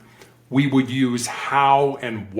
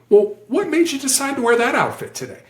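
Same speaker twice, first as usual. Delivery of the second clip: high, lilting, non-accusing question; softer question; 10 semitones higher than usual. Shown as a demonstration. Negotiator rephrasing the why question, gently curious. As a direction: ask it lightly, with a high curious lift. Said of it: "That's a softer question".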